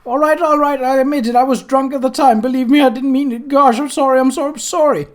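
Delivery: high, loud, panicked pleading; squealing in fright. A narrator's reading. Terrified wizard squealing his apology to the statue. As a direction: beg for mercy in a squealing panic. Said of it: "The man squealed".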